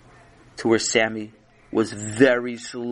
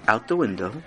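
usual speaking voice

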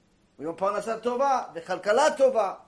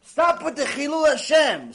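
loud, raised-pitch command; stern rebuke; 5 semitones higher, 6 dB louder than usual. Acted. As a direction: admonish the congregation forcefully. Preacher voices God rebuking congregation.

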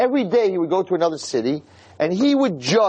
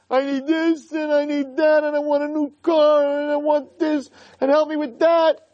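high, whining list of wants; mock demanding; 10 semitones higher than usual. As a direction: chant the demands like a nagging child. Mimicking people's demanding prayers.

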